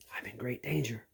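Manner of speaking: hushed, breathy storyteller whisper; a storyteller whispering to scare the kids; spooky whisper. A narrator's reading. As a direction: whisper it like a scary secret.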